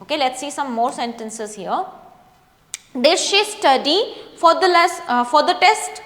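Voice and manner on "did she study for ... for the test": slow, clear model sentence with a rising question; slow model reading. Shown as a demonstration. English teacher reading a question aloud for students, slow and clear. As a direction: read it out very slowly for the class to repeat. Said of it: "हमें बोल करके भी practice करनी है".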